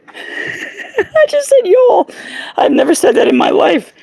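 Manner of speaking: very high, laughing squeal; laughing disbelief. Real, not acted. Amused at himself for saying y'all, laughing high.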